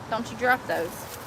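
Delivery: ordinary talk, nothing marked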